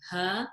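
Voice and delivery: ha low in the throat with no air; ha said low from the bottom of the throat; deep ha. Shown as a demonstration. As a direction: go deep for ha, no breath on the hand. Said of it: "feel nothing on your hand".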